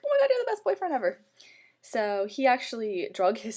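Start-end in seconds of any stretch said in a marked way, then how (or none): 0.1-1.2 much higher, louder, fast squeal; thanking her boyfriend for the gift, high and fast; thrilled. Retold or imitated. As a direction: gush with delight. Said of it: "I was like".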